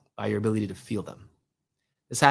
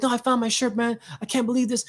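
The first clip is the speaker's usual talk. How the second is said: upset gym guy; 12 semitones higher than usual. Retold or imitated.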